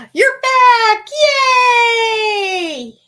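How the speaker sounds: put-on high loud delighted kid cry; little child. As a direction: squeal like an excited little child. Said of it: "I'm like a little child".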